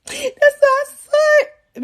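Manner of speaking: high, very drawn-out happy wail; hammed-up tearful squeal, stretching each word; mock crying. New mother hearing her baby cry, mock crying. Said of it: "It's me crying like".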